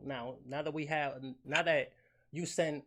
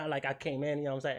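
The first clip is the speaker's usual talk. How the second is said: usual speaking voice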